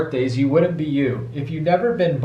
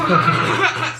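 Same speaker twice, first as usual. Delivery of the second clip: high laugh; 12 semitones higher than usual. Real, not acted. Dad laughing at his daughter's joke. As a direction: burst of laughter.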